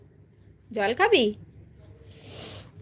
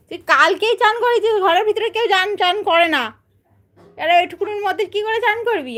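high, playful telling-off of the bird; mock scolding pet; 6 semitones higher than usual. Real, not acted. (উঁচু গলায় পাখিকে মজার বকুনি)

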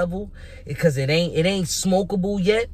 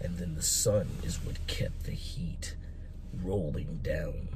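[name] impression; 11 semitones lower, 12 dB quieter than usual. Vlogger imitating a rapper's deep voice to hype the battle. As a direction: lower it all down into a deep, quiet growl of a narrator. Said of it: "my best [name] impression".

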